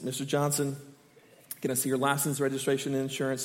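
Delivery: a flat official request, lightly voiced; officer voice. Teen retelling the officer at his window, a low hint of a cop.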